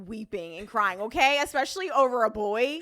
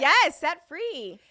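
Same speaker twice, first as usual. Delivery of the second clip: slow, loud proud declaration; triumphant; 6 semitones higher, 7 dB louder, much slower than usual. Real, not acted. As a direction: savour the freedom. Triumphant repeat.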